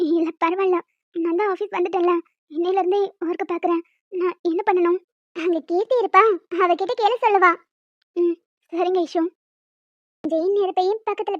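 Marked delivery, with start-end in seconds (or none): none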